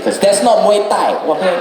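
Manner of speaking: ordinary delivery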